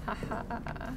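ordinary talk

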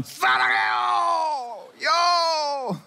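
high, very slow, drawn-out shouted call; mock lover's yell. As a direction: holler across the park, hamming it up. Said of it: "Another one".

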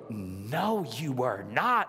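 mock-indignant disbelief; low, scandalised mimicry; indignant lady. Lady behind us as the kid cuts the line, mock indignation. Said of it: "this lady behind us who went".

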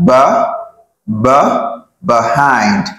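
BUH alone, repeated after pauses, then BEHIND; short BUH, pause, whole BEHIND; syllable demonstration. Shown as a demonstration. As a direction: isolate the first syllable of BEHIND. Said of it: "Here I have b".

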